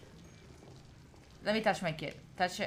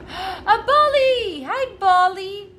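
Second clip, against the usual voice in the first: high, loud, slow excited cry; light up on seeing a beloved pet; delighted greeting; 9 semitones higher, 10 dB louder, much slower than usual. Real, not acted. Finds pet, gasps, greets.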